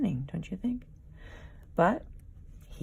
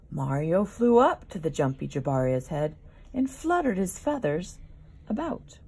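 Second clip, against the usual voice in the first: usual speaking voice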